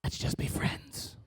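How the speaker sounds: slow, near-whispered brush-off; hushed let-down. Acted. Comedian turning down evangelical suitors, hushed. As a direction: let them down gently, almost voiceless.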